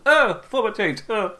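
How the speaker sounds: high, loud startled outburst; mock sudden alarm. Host joking about springing a format change, mock alarm. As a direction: blurt it out as a sudden shock. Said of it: "It's not gonna be a sudden".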